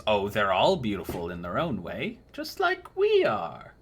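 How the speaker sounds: put-on a doting old crone cooing over a young knight; sugary old lady flattering the customer; old crone baker. Old woman.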